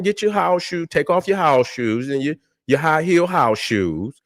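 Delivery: ordinary delivery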